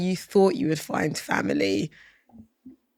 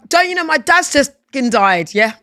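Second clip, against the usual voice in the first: louder, higher imitated fury; mock outrage; 5 semitones higher, 6 dB louder than usual. Voicing her grief-struck anger at the smiling clerk, louder. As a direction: throw it out like a grieving rage. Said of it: "It's like".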